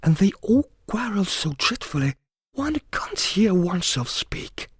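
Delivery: high, plaintive account; complaining. A narrator's reading. Alice telling the cat about the game, complaining. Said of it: "in rather a complaining tone".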